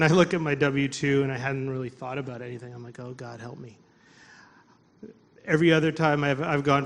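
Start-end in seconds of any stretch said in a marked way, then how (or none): 3.0-3.8 low-key muttered plea, much softer; quiet, resigned appeal under the breath; dismayed plea. Retold or imitated. Looking at his W two, quiet dismayed plea to God. Sighing prayer on seeing tax form.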